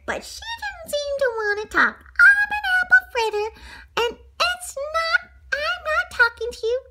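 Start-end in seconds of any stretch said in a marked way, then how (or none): 2.0-6.8 very high haughty pastry voice; snooty Apple Fritter. Acted. Apple fritter refuses to talk to Arnie, high and snooty. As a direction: snub him in a high put-on voice.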